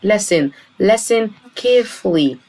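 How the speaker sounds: slow and clear, drop the t in lesson and listen; silent t. Shown as a demonstration. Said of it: "منطقش حرف التي".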